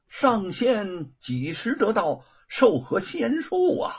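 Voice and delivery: lightly voiced host, high and slow; a drawn-out deferential question; courteous Dragon King. A narrator's reading. Dragon King greeting an immortal guest, courteous inquiry.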